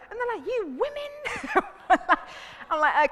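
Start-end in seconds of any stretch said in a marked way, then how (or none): 0.5-2.2 very high, drawn-out mock protest; mock exasperation. Men exasperated with women, high mock protest. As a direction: stretch it out loud in disbelief.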